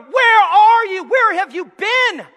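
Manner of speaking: loud, slow, high calling; God pleading with his people. Acted. God calling the lazy believer, loud and slow. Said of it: "And God's going".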